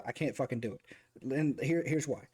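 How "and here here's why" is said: ordinary talk, nothing marked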